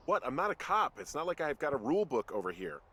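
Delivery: high-pitched defensive comeback; indignant protest. Acted. New lookout defends himself to his boss, indignant protest. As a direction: push back, exasperated.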